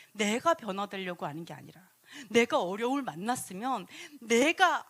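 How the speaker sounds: normal talking voice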